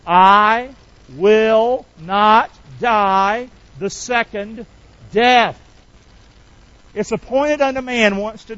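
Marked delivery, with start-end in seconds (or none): 0.1-5.6 each word drawn out slow and loud; slow declaration. Shown as a demonstration. Pastor leading the church in a slow declaration. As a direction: lead the church word by word, slowly. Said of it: "Would you say that with me?".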